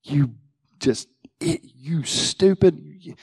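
slow whispered self-rebuke; slow whispered scold at your own mistake. Retold or imitated. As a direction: hiss it at yourself, slowly. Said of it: "You're like".

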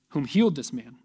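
normal talking voice

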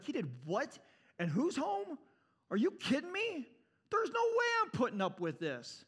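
high, incredulous outrage; indignant, rising fury; red-faced anger. Acted. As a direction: act the irate son, voice jumping up in disbelief. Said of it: "the red-faced anger that this kid has".

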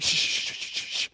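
hushing. Retold or imitated. Dad calming his screaming daughter, hushing her. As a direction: hush a frightened child. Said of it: "And he's just like".

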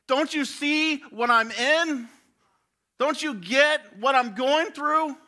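very high, loud, slow, pleading cry; a hurt kid shouting back in disbelief; abused child protests the empty comfort; anguished child. Acted. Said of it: "he is looking at his life going".

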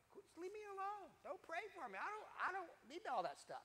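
high, whiny brush-off, lightly mocking his younger self; brushing her off. Preacher mimics his teenage self telling the friend off, whiny. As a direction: push her away like an annoyed teenager. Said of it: "And I keep going".

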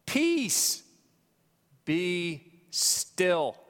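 high, loud commanding call, then settling; Jesus commanding the storm. Acted. Jesus speaking to the storm, commanding and loud. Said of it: "what he said".

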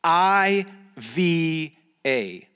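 very slow, each letter drawn out on its own; slow, deliberate letter-by-letter; spelling out letters. Shown as a demonstration. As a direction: teach the letters one at a time, stretched long. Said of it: "Here are the three letters".

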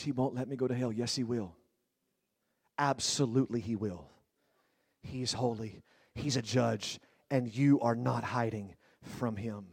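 very soft, low sermon voice; hushed preaching. Acted. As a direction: drop to a grave hush. Preacher drops to a hush.

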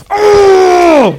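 loud, high, drawn-out angry cry; hammed-up howl of frustration; mad king's yell. Furious king reacts to Balaam's blessing, enraged yell. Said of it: "He was mad".

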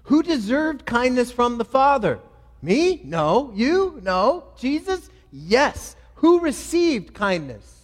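loud question-and-answer preaching, questions pitched high; forceful preaching. Real, not acted. Rhetorical questions preached loudly.

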